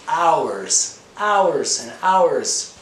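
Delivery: "hours" punched high and loud, falling away; emphatic. Real, not acted. Teacher telling learners how long he watched videos, emphatic. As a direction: hit each HOURS hard and high.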